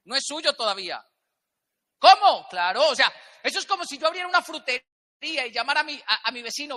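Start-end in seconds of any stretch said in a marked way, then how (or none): 2.0-2.5 sharp, loud and high; a shocked "¿Cómo?" thrown at the room; surprised. Acted. Speaker reacts in disbelief to the audience, surprised.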